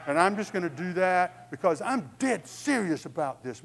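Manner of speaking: loud, emphatic pledge; hammed-up determination; mock-serious resolve. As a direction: declare it forcefully, as if deadly earnest. Mock resolve about trivial diet pledge.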